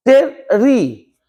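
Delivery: टेरी drawn out very slowly, the r held; slow syllable demo. Shown as a demonstration. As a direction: stretch टेरी for the class. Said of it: "clear कीजिएगा". (खिंचा हुआ टेरी)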